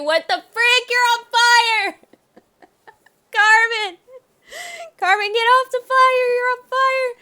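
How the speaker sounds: high, loud, frantic screaming; panicked yelling. Real, not acted. Player screaming at Carmen to get off the fire. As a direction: shriek at her to get off.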